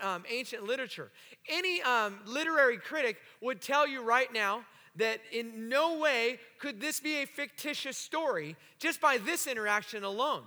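ordinary talk

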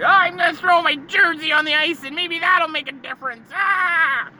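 high, loud whiny fan voice; loud high mock-despair wail; mocking Leafs fan. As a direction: mimic a melodramatic fan throwing his jersey. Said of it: "and it's like".

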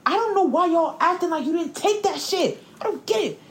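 high, incredulous rant; exasperated. Real, not acted. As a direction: sound fed up and baffled. Venting about anti-vax nurses.

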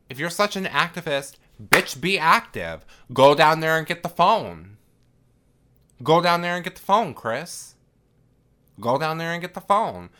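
loud, high, rapid-fire mock berating; play-scolding at high pitch and volume; exasperated scolding. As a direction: order the character to act, pushing each repeat. Speaker scolds the character, urging action.

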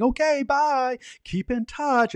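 high, chirpy mock wave-off; cheery narrator goodbye. Narrator waving the couple off with a high chirpy bye. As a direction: sing out a bright high bye. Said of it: "waving to them and saying".

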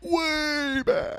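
announcer call. Retold or imitated. Home run call.

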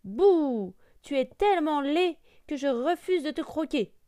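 barking big voice. A narrator's reading. Hunter's dog rebuffs the duckling, barking loudly. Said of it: "aboya le chien de sa grosse voix".

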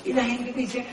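ordinary talk, nothing marked

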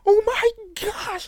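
very high, loud, drawn-out gush; overly excited. Retold or imitated. Friend greeting her at the mall, mock overexcited. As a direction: imitate an over-the-top excited greeting. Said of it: "like, overly excited and stuff".

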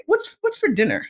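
casual shrug. Retold or imitated. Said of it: "And then move on with your day".

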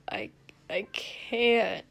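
slow, breathy hungover groan; sick and weak. A narrator's reading. Sick prince refusing the drink, weak groan. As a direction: groan it weakly, haltingly.

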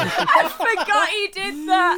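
laughing. Real, not acted. Friend recalls the wizard scene, laughing. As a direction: crack up while remembering it.

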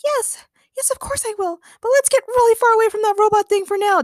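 hurried high acceptance, building to urgency; frantic heroine. A narrator's reading. Daniela answering Daniel as they flee, frantic. Fleeing woman accepts proposal.

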